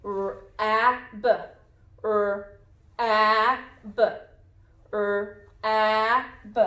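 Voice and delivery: R, a, b as isolated sounds: er, short a, buh; sounding out. Shown as a demonstration. Teacher checks the vowel sound, R, a, b each apart. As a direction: say R, a, b as sounds with pauses, not letter names. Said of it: "What vowel sound does this A make?".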